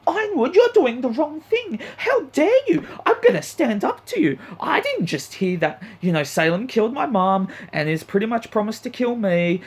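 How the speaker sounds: high, squeaky mock of an indignant girl; mocking Ruby. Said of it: "she gets up".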